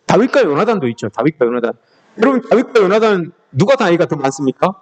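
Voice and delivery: ordinary talk, nothing marked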